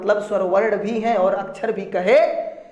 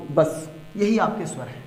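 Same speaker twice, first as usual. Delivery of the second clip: ordinary delivery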